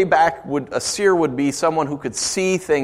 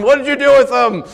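higher, half-playful accusing question; mock interrogation; 8 semitones higher, 4 dB louder than usual. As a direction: grill the kids in jest. Parent interrogating kids about keys.

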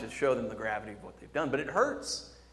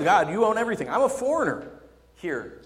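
raised, loud appeal to God; emphatic plea; 7 semitones higher, 7 dB louder than usual. Acted. Preacher voicing David before God, emphatic plea. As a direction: climb higher as you declare it.

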